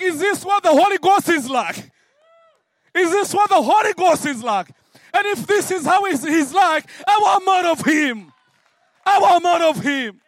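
loud, high, overwhelmed exclaiming; amazed shout. Retold or imitated. A man overwhelmed by the Holy Ghost shouting in amazement.

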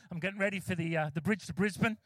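ordinary delivery

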